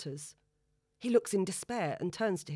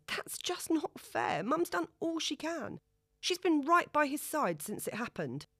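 pleading daughter; 10 semitones higher than usual. A narrator's reading. Daughter defends her mother to her father, pleading. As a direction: stand up for your mum.

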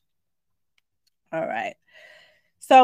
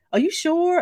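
pitched-up, startled "are you sure"; surprised question; 8 semitones higher, 3 dB louder than usual. Retold or imitated. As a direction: ask it in disbelief, voice jumping up. Asking stranger, surprised.

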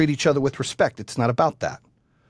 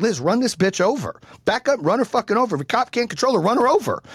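higher-pitched, heated urging; urgent angry order; 8 semitones higher than usual. Retold or imitated. Husband urging wife to drive off, urgent and angry. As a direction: re-enact frantic orders to the driver.

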